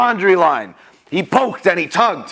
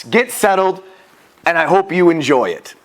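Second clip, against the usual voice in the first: usual speaking voice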